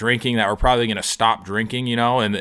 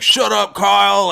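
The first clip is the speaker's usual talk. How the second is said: high, loud mocking shout; shouted at the buzzkill friend; group yelling at him; 10 semitones higher, 6 dB louder than usual. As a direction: yell him down like an annoyed crowd. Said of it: "just fucking laying into me".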